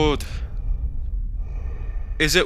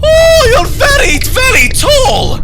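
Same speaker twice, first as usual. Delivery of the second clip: much higher, louder shriek, stretching very tall; shrieking surprise; 6 dB louder, slower than usual. Real, not acted. Player comes face to face with the tall man, high shocked shriek. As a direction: squeal it in shock at his height.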